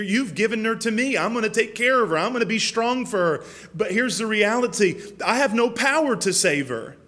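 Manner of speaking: high, urgent pleading with God; impassioned protest. Retold or imitated. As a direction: argue desperately, reenacting a father's protest. Reenacts his anguished answer to God.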